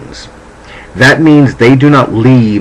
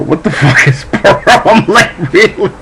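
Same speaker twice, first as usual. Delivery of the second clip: higher, laughing through the words; laughing mock outrage; laughing disbelief; 10 semitones higher than usual. Real, not acted. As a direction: crack up at the absurdity. Laughing while retelling disbelief.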